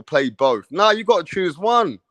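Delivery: ordinary talk, nothing marked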